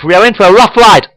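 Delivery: fast, high-pitched battle shout; shouted warning. A narrator's reading. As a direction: call it out urgently to the crew. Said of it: "shouts Chekhov".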